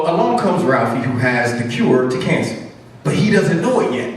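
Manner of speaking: usual speaking voice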